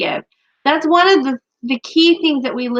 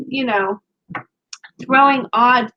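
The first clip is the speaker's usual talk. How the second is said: ordinary talk, nothing marked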